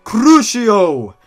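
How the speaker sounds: very loud, high shouted spell; shouting. Acted. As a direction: shout the curse at full volume. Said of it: "grabbed his wand and shouted".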